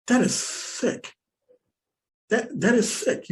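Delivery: slow, high disgusted verdict; horrified and slow, a little high; appalled. Real, not acted. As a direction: drag it out in disbelief. Appalled at hunting for sport.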